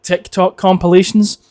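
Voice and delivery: usual speaking voice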